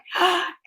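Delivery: quick gasp of surprise; delighted gasp. Real, not acted. Streamer sees Blair join the stream, gasping. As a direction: gasp on spotting a friend. Spots a viewer she knows.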